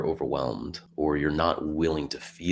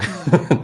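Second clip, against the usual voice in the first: laugh; 10 semitones higher, 9 dB louder than usual. Real, not acted. Laughs after joke.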